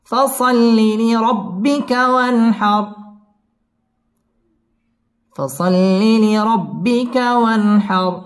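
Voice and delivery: slow melodic chant, long held vowels; Quran recitation. Shown as a demonstration. Teacher models the verse for students, slow chanted tajweed. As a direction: intone each word, drawn out and high.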